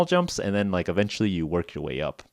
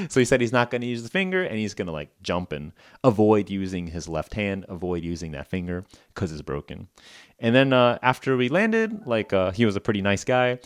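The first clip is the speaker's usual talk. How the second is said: usual speaking voice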